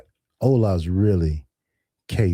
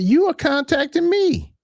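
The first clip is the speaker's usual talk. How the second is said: mock exasperated. Retold quarrel, own reply.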